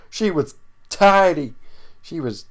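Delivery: high, drawn-out silly emphasis; hammed-up admiration that jumps into a squeal; joking exaggeration. Joking riff on beautiful woman.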